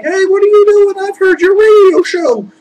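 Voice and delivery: high, loud eager fan voice; a starstruck little guy gushing; eager little mortal. Retold or imitated. Abel the little mortal gushing to Joe, high eager voice.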